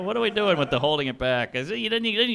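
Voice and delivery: usual speaking voice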